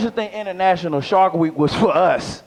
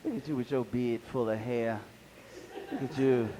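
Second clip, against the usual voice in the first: mock taunting voice; 9 semitones lower, 13 dB quieter than usual. Mocks himself in mirror.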